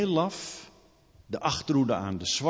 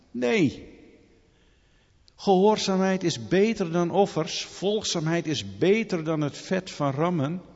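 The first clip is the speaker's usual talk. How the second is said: preaching at full force; 5 semitones higher, 4 dB louder than usual. Acted. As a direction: thunder the verse out to the church. Preacher proclaims scripture with force.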